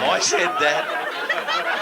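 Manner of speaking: loud, high, drawn-out protest; emphatic insistence. Real, not acted. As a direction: stretch each word out loudly, insisting. Insisting against a heckling sceptic.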